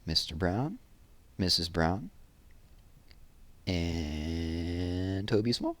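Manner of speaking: quiet, slow bedtime read; soft closing. Acted. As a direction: ease the story down gently. Closing soft story reading.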